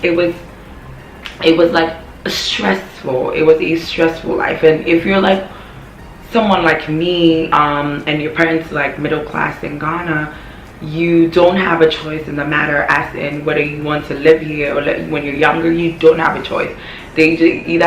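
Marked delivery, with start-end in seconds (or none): none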